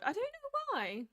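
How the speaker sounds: usual speaking voice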